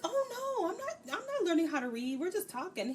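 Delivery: high, mock-innocent protest; flustered denial. Fee denies the reading lesson, hammed-up flustered protest. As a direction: squeal a retold denial, then ease down. Fee's denial acted out once more.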